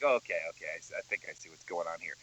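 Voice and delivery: low, soft and fast; muttering to self. Retold or imitated. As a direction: mumble it quickly under your breath. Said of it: "You're like".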